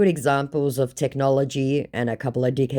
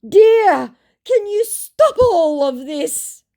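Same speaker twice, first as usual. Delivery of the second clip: much higher, slow, whiny put-on voice; mock pleading scammer; 8 dB louder, much slower than usual. Caught scammer begging her to stop, hammed-up whine.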